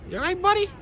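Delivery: very high, squeaky-cute question; high animal talk. Real, not acted. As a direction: pitch it way up, as to a pet. Repeats it much higher to duck.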